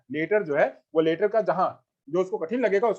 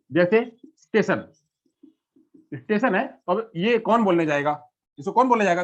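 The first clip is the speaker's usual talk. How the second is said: ordinary talk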